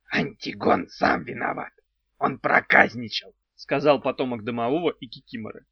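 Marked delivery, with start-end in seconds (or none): 0.1-3.3 odd creaky little house spirit; goblin voice. Acted. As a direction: play a quirky house goblin, slowly.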